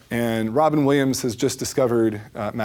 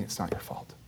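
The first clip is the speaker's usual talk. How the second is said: barely whispered, much softer; 5 semitones lower, 14 dB quieter than usual. Retold or imitated. Counselor whispering it to the angry young man, very soft. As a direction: breathe it out as a whisper. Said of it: "And he keeps saying".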